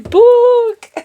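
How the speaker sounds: high, loud imitated scream of "Book!"; mock scream. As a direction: shriek "Book!" like the movie witch. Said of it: "that's what I wanted to start yelling".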